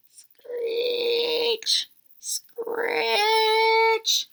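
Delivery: high, whispered, scratchy screech; eerie screech. Acted. Night noise at the bedroom window, high whispered screech. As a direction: make the spooky window noise.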